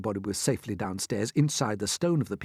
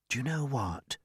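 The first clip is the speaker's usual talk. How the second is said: soft, hushed voice; whispered; 6 dB quieter than usual. A narrator's reading. James confiding to the frightened travellers, hushed. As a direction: share a secret. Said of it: "James whispered".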